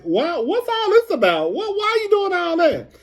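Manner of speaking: high-pitched imitation of indignant questioning; mock indignant challenge. Imitating Maurice and Kimmy questioning a castmate, mock indignant. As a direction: pitch it way up, as if demanding answers. Acts out couple challenging someone.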